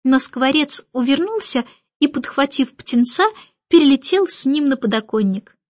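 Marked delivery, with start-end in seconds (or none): none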